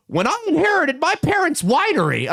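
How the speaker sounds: very high mock squeaky voice; exaggerated high-pitched hustler impression; Gary Vaynerchuk. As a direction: squeak out the boast in mockery. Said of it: "he's got the squeaky voice".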